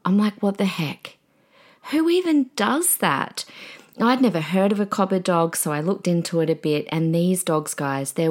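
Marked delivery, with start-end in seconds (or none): 0.4-3.5 high, slow, stunned disbelief; amazed disbelief. Retold or imitated. Retelling a stranger's gift offer, high stunned disbelief. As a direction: let the pitch leap up in shock.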